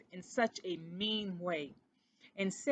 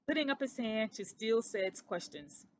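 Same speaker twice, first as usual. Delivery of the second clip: ordinary delivery, nothing marked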